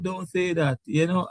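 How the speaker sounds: ordinary talk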